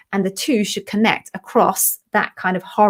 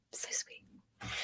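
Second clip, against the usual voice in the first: breathless whisper of being moved; 25 dB quieter than usual. Real, not acted. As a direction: whisper it to yourself, touched. Moved by viewer comment.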